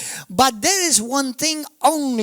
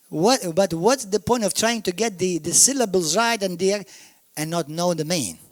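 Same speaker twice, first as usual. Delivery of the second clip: ordinary delivery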